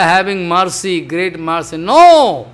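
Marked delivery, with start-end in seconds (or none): 1.8-2.5 sharp, louder no; emphatic no. Acted. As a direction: shut down the excuse with a firm no. Preacher rejects mercy as weakness.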